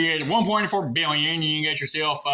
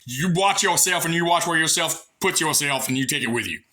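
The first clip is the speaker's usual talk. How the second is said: usual speaking voice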